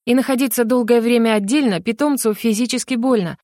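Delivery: plain reading voice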